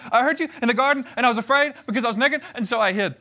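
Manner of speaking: put-on fast, high blurted confession like a scared kid; a little boy rushing out the bad news to his parents; scared four-year-old, rushed. Shown as a demonstration. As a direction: blurt it all out at once like a caught child. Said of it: "four-year-old giving bad news very, very quickly".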